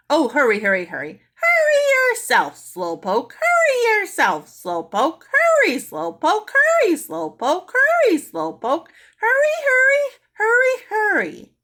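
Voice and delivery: very high, loud, slow taunting squawks; a parrot mocking Mary's dawdling; mocking parrot screech. A narrator's reading. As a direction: screech it to tease a slowpoke. Parrot mocks Mary's hurry.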